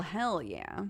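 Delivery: ordinary talk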